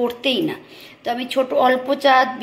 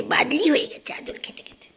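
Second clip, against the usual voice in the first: high doting whisper; 5 semitones higher than usual. Real, not acted. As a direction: owner whispering to the pet. Whispering to pet.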